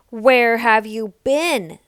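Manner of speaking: big blustery voices. A narrator's reading. The big sisters demand where Nell has been. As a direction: loom over her. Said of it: "big, blustery voices".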